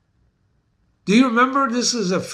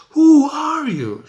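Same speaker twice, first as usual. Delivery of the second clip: high, slow, drawn-out Who are you; mock the Caterpillar's lofty question; Cheshire Cat asking; 9 semitones higher, 6 dB louder, slower than usual. Teacher imitating the Wonderland creature, high and slow. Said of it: "Like Alice in Wonderland with the Cheshire".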